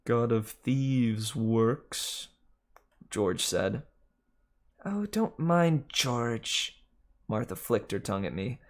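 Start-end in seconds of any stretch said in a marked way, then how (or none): 0.0-2.3 very slow, grumbling snake; slow sulky male snake voice; George the snake. Acted. George drily. 4.8-6.8 higher, very slow teasing snake; high slow female snake; Martha the snake. Acted.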